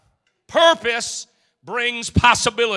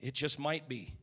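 quiet hopeful thought; 8 semitones lower, 14 dB quieter than usual. Retold or imitated. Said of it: "climb in your heart saying".

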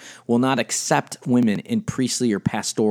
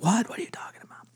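hushed, unvoiced disbelief; mock-shocked whisper of people hearing this; 9 semitones higher, 8 dB quieter, faster than usual. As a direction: whisper it like a scandalized listener. People's shocked reaction, quoted.

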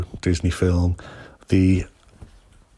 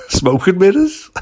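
higher, rising smoke and mirrors with a laugh; amused correction; 10 semitones higher, 3 dB louder than usual. Real, not acted. Guest laughing at his own slip, unsure. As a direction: checks the fix with a grin. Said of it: "Cloak and mirrors?".

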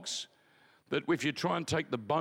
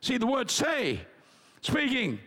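ordinary delivery

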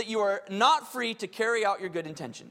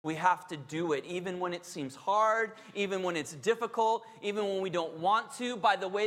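ordinary delivery

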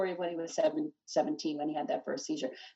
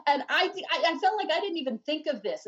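laughing excitedly; 8 semitones higher, 5 dB louder than usual. Real, not acted. Overwhelmed recalling writing it.

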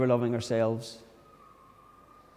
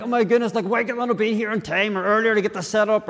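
exasperated; 7 semitones higher than usual. Retold or imitated. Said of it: "Or like".

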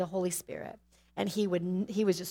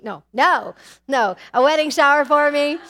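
loud, higher blurted correction; laughing correction; 6 semitones higher, 10 dB louder than usual. Real, not acted. Correcting a slip to the congregation, loud and laughing. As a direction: catch the slip and shout the fix. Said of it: "I have nothing hidden, guys".

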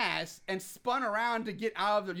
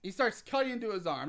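usual speaking voice

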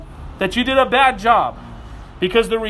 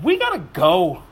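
ordinary delivery

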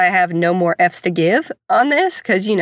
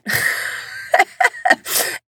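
airy unvoiced giggle, pitched up; breathy high laugh; 14 semitones higher, 3 dB quieter than usual. Real, not acted. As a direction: let a soft breathy laugh slip out. Laughing at own story.

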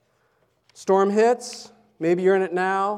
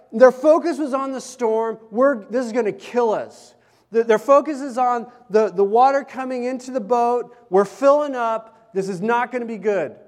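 louder, rising preacher's fervour; preaching; 4 semitones higher, 6 dB louder than usual. Acted. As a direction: drive the point home from the pulpit. Preaching at full force.

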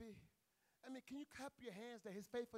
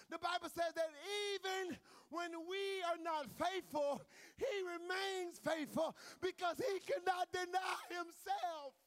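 full-force preaching; 4 semitones higher, 6 dB louder than usual. Real, not acted. Preacher crying out to the church about God's mercy, loud. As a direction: preach it out loud to the back row.

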